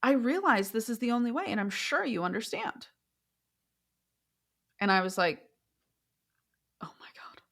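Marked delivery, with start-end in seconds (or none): 0.0-2.9 higher, composed voice imitating her certainty; calm, collected woman. Woman justifying arson to her assessor, calm and collected. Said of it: "how calm, cool, collected she was". 6.8-7.4 barely audible whispered reaction; stunned whisper. Retold or imitated. Clinician reacting to an arson confession, stunned whisper.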